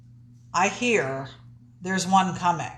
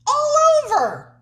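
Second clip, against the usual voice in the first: excited; 5 dB louder than usual. Real, not acted. Confident loud answer.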